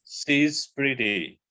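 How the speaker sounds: She's pretty said very slowly, each word drawn out; slow demo. Shown as a demonstration. Teacher demonstrating a contraction to students, slow and deliberate. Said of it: "Kalau kita bilang begini".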